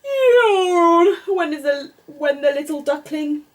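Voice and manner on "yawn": voiced yawn.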